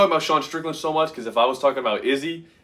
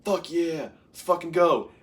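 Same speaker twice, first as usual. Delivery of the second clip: high pumped-up cheer; cheering a fighter on under his breath; excited cheer; 6 semitones higher than usual. Retold or imitated. At work watching the fight, hyped cheer.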